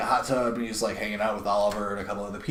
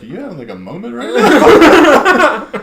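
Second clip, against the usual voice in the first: teasing laugh; 12 semitones higher, 14 dB louder, slower than usual. Retold or imitated. Barbara teasing Clark.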